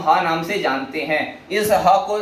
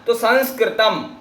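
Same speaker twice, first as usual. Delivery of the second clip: ordinary talk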